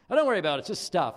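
mock careless reassurance. Pastor mocks careless comfort to victims.

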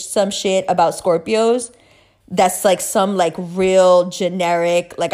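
ordinary talk, nothing marked

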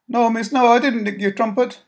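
a scared urchin begging; frightened boy. Acted. Caught boy pleading with Mary, high and scared.

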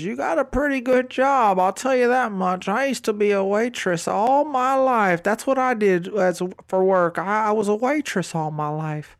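a hint of a friendly older waitress chatting; chatty older woman. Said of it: "she goes".

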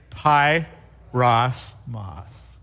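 "Pie, Ross, moss" chopped apart, a break after each syllable; "Pie" then "Ross" then "moss", separated and fading. Shown as a demonstration. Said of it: "Let's try it together".

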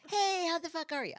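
New York greeting. Retold or imitated. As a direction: fling it out like a loud Jersey guy. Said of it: "he goes".

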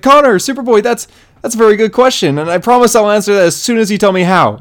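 high-pitched, eager imitation of a startled kid; excited surprise. Bart answering Superboy's question on finding him, excitedly surprised. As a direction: open high and loud, then keep up the excited pitch.

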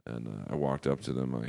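ordinary talk

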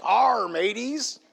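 drawn-out pirate "Arr"; slow mock pirate call; pirate greeting. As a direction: greet the crew like a pirate, stretching it long. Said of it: "start to talk like a pirate".

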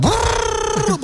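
long high brrrr like a roaring engine; revving engine noise. Retold or imitated. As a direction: roll it out slow like a revving car. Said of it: "bas bas bağırıyor".

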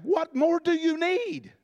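high, slow, incredulous cry; a mock scream at the Pharisees, high and slow; pretend scream. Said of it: "I wanna scream at 'em".

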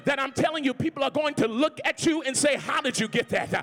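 loud, rising sermon climax; shouting gospel preacher; full-force preaching. Acted. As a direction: preach it out to the back row. Preaching to the congregation.

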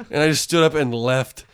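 ordinary delivery

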